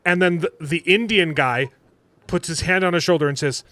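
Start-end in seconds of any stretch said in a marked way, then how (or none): none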